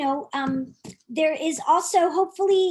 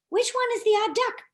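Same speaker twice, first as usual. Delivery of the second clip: put-on higher, teasing question to kids; playful question; 7 semitones higher than usual. Storyteller quizzing the children, higher and playful. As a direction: ask the little audience brightly.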